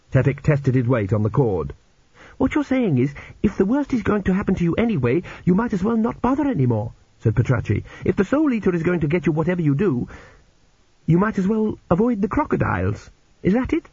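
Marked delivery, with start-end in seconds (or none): 2.4-6.9 put-on high-pitched young woman's character voice; condemned girl reasoning. A narrator's reading. Girl reasons with her rescuer, high-pitched and quick. 8.0-13.9 put-on high-pitched young woman's character voice; condemned girl reasoning. A narrator's reading. Girl reasons with her rescuer, high-pitched and quick.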